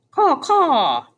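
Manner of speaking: high harsh caw, falling at the end; crow cawing. A narrator's reading. Crow mistaken for Pellegrina answers Edward, harsh cawing.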